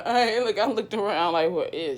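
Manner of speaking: loud laughing exclamation. Retold or imitated. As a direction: laugh through the words. Laughing retelling.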